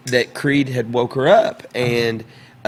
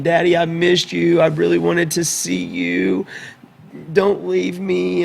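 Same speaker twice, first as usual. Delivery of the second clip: higher, pleading little-girl quote, lightly acted; mildly acted upset daughter, raised pitch; retold tearful child; 7 semitones higher, slower than usual. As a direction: imitate a child's drawn-out plea to a parent. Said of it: "she comes running to me crying".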